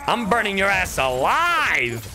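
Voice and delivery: usual speaking voice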